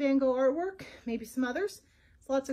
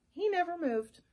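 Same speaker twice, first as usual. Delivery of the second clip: plain reading voice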